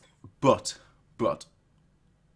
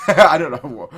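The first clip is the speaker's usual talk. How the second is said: laughing; 4 semitones higher, 14 dB louder than usual. Real, not acted. Laughs at own joke.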